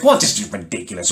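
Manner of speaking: normal talking voice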